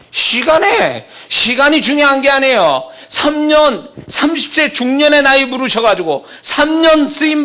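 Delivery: fiery sermon voice; full-force preaching. Acted. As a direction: drive the point home to the congregation.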